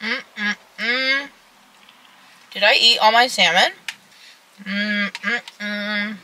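humming with closed lips; savoring food while eating; mouth full chewing. Real, not acted. Seen 4: Holds salad bowl and fork, closed mouth chewing while humming.

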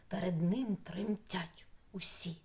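whispered, breathy and hushed; spooky whisper. Acted. Teacher whispering to children about the scary tiger. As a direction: hush it like a secret about a scary beast.

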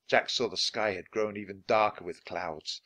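ordinary delivery, nothing marked

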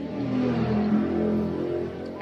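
long, loud mmm of delight; savoring hum. Real, not acted. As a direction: hum with pleasure at the taste. First bite of batagor, tasting.

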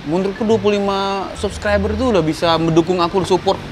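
ordinary talk

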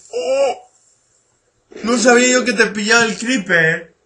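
loud, high startled outburst; sorpresa a voces. Real, not acted. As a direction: exclaim it loudly in surprise. Caught by the creeper. (exclamación fuerte y aguda de sorpresa)

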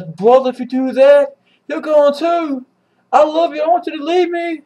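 a hammed-up tearful kid brother; pleading little brother. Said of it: "Itachi says".

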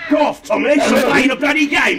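high-pitched scolding shout; yelling at Tommy. Acted. Gamer ordering Tommy off the game, scolding shout. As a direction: shout over at someone to quit playing.

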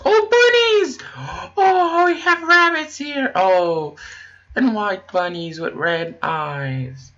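squealing high over the bunnies; excited squeal. Real, not acted. Player spotting bunnies, squealing high with delight.

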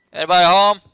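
calling out. Pretend. Calling into empty house.

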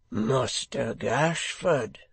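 slow, drawn-out surprised greeting; evident surprise. A narrator's reading. Man opening the door to an unexpected secretary, surprised. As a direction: greet him slowly, taken by surprise. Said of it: "in evident surprise".

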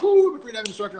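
high, bright retold glee at passing; retold excitement. Retelling his joy at becoming a freediving instructor, excited. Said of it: "I was super excited".

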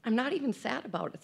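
fighting back tears mid-sentence; choked up, trying to keep talking; real crying. Real, not acted. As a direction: voice catching with tears. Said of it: "so I don't know why I'm crying".

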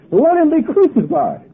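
high, loud acted demand; crowd's shout. Crowd answers Pilate about Jesus, acted shouted demand. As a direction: call it out like one of an angry mob.

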